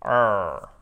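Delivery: low, drawn-out English R sound; English R demo. Shown as a demonstration. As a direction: hold the "R" long and low, English-style. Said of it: "Chaque fois, vous avez le". (R anglais grave et prolongé)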